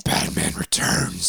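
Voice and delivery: slow, hoarse growl; whispery superhero growl; Batman. Acted. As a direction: rasp the title like the hero. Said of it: "you have to say it like".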